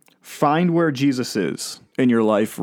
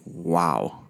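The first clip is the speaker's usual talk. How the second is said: a soft, much quieter "Wow" said under the breath; hushed inner wow; 6 semitones lower, 6 dB quieter than usual. Retold or imitated. To himself after hearing a detained teen, a hushed stunned wow. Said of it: "pausing and just thinking".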